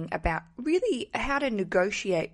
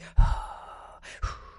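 heavy huffing sigh. Retold or imitated. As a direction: huff and puff like a sweaty gym man. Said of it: "he was doing this".

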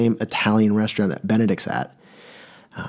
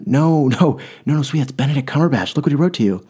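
thrilled, insisting on the gift; eager mom; 6 semitones higher than usual. Retold or imitated. Mother eagerly telling her daughter who signed it, a touch excited.

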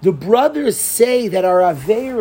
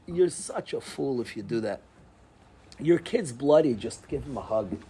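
much softer, lower and gentle; quiet advice; 8 semitones lower, 10 dB quieter than usual. Real, not acted. Rabbi gently advises fathers, dropping to soft. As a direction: ease off, just say it softly.

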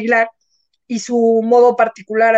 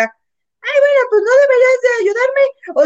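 much higher mocking girl voice; 11 semitones higher than usual. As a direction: parody her whiny reply. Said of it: "cuando dice [name]".

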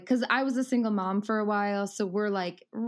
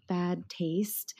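whisper; 3 semitones lower, 5 dB quieter than usual. Real, not acted. Aside about son's opinions.

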